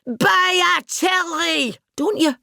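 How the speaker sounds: high, slow exasperated cry; half-hearted mock yell; mock scream. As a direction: plead in frustration. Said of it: "You just want to scream".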